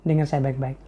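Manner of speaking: ordinary talk, nothing marked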